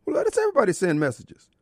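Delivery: usual speaking voice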